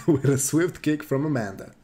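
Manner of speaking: ordinary delivery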